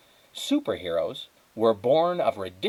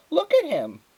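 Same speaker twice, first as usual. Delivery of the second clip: high and louder, on the edge of laughter; amused neighbour; 6 dB louder than usual. Acted. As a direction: neighbour marvels at the dazed squirrel, high and loud. Neighbour starts laughing at squirrel.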